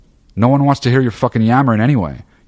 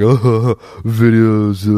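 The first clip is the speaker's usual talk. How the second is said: mock dopey viewer; 3 semitones higher, 4 dB louder, much slower than usual. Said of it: "just clicking around like".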